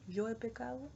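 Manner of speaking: usual speaking voice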